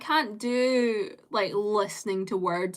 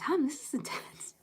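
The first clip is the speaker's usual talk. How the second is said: whispered mock alarm; breath-only, softer retold reaction; hushed reaction; 5 semitones higher, 6 dB quieter than usual. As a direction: whisper it as a stunned aside. Retelling own reaction to stare.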